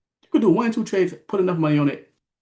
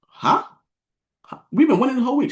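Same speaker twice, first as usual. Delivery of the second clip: high-pitched, incredulous challenge; retold disbelief; 12 semitones higher, 6 dB louder than usual. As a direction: question them in loud, high disbelief. Retelling disbelief at her loss.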